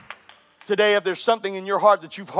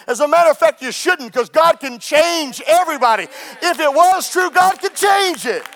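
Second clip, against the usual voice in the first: loud, high preacher's cry; impassioned, raised-voice sermon; full-force preaching; 6 semitones higher, 4 dB louder than usual. Real, not acted.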